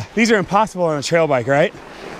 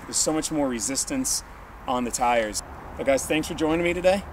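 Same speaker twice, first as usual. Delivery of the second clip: softer, relaxed sign-off to viewers; vlog outro; 5 semitones lower, 10 dB quieter than usual. Acted. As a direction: wrap up the video calmly.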